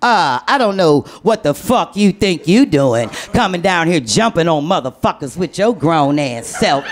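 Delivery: low, slow scolding mother voice; mama on the phone. Retold or imitated. Mother scolding her daughter over the phone, low and slow. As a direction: growl it like an angry mama. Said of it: "my mother calls over".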